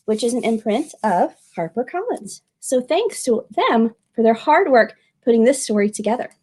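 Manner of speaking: plain reading voice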